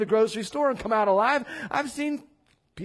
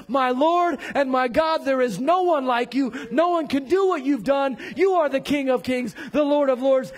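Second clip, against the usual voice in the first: loud, driving preacher's crescendo; impassioned proclamation; 4 dB louder than usual. Acted. Preacher declares faith to the King of Kings, loud impassioned proclamation. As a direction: pile up the titles with fervor.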